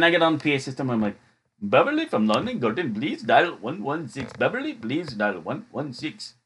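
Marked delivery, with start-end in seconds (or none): 1.6-6.4 slow, singsong caricature voice; ill-timed put-on voice. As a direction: page the store in a swooping comic voice. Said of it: "did a voice that I shouldn't have".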